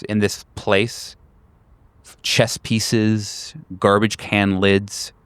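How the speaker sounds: normal talking voice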